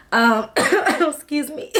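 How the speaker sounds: slow, hoarse after a throat clear; strained voice clearing the throat. Real, not acted. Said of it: "Try to come back to myself".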